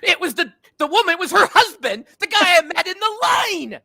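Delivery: loud, high, incredulous laughing reveal; building to a high, loud, tickled exclamation; laughing punchline. Real, not acted. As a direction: cry out the punchline through laughter. Teller reveals punchline, cracking up.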